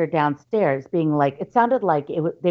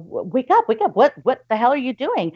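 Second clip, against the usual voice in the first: alarmed; 8 semitones higher than usual. Retold or imitated. Waking brother in alarm.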